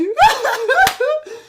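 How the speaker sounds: very high, loud cackling burst; shrieking laugh. Real, not acted. Reacting to a cuber, shrieking laugh. High outburst after shouting.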